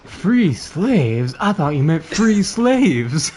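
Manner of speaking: high, loud, slow mock disbelief; mock shocked. Reacting to the joke, hammed-up shocked disbelief. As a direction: act scandalised.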